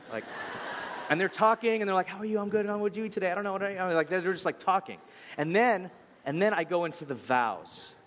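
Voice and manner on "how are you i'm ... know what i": giddy couple chatting. Retold or imitated.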